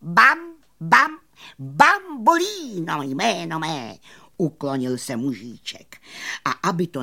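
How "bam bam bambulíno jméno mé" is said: high, loud theatrical name announcement; showman magician. Acted. Magician presenting himself to his audience, high and loud. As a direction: proclaim it with a big flourish.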